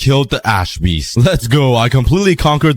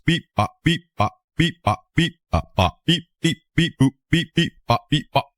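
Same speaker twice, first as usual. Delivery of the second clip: beep boop noises; 4 semitones higher than usual. Pretend. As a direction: chirp it like a little robot. Beeping robot noises while crafting.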